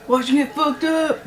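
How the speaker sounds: high, gleeful fight hype; hyped-up yell. Real, not acted. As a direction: cheer it out, fired up. Hyping the main event.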